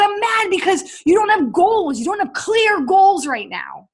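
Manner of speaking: a touch of real anger replayed; retold exasperation. Arguing with husband about goals.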